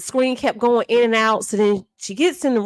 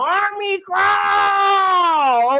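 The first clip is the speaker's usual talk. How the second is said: drawn-out exclamation; 5 semitones higher, much slower than usual. Real, not acted. Exclaiming the army crawl.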